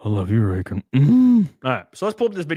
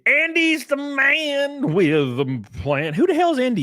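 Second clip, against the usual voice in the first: much higher, slow sing-song hype; playful excitement; 10 semitones higher, slower than usual. Real, not acted. Streamer hyping up chatter Andy, high and sing-song.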